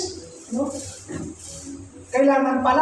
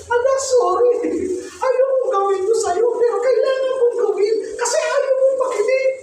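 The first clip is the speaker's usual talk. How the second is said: higher, tearful voice with a sob at the end; tearful apology; 6 semitones higher than usual. Retold or imitated. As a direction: retell the apology as you hold back tears. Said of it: "pati ako umiyak".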